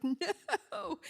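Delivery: high, loud mock "no"; retold yell. Principal to the retiring teacher in her office, retold protesting yell. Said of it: "I yelled at her".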